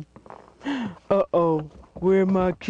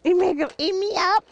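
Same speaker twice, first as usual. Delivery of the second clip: high, fast squeaky panic; a tiny toy crying for help; squeaky scared toy; much faster than usual. Pretend.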